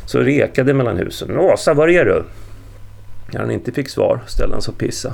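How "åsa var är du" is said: loud, raised searching shout; bellowed question to someone out of sight; roaring call. A narrator's reading. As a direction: call out loudly between the houses. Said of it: "vrålade han".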